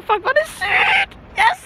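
very high, drawn-out excited squeal; thrilled squeal. Real, not acted. Learner driver overwhelmed at passing, thrilled squeal. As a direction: let it soar up in real disbelief. Still celebrating the passed test.

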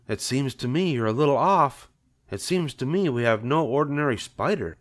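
a wife doubting her husband; skeptical wife. A narrator's reading.

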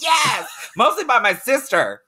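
high-pitched exclamation; emphatic outburst. Real, not acted. Host answers the guest about the beatings, high emphatic outburst.

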